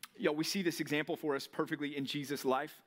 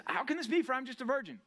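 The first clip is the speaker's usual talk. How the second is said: put-on higher, puzzled young woman asking; Mary asking; 8 semitones higher than usual. Preacher voicing Mary questioning the angel, higher and puzzled. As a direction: ask it wide-eyed and amazed. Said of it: "He says".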